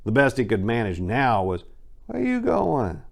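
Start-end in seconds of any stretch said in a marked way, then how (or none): 2.1-3.0 much higher, wasted party guy calling out; drunk friend's call. Acted. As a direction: toss it after her from the couch, sloppy and high. Drunk friend calling after Maria.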